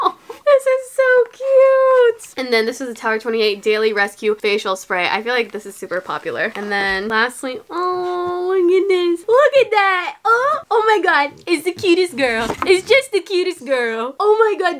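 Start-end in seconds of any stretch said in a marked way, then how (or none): 0.1-2.3 high, drawn-out squeal of delight; delighted gush. Real, not acted. Gushing over cute packaging. 7.7-14.8 high-pitched pet-talk cooing; adoring coo. Real, not acted. Cooing over her dog.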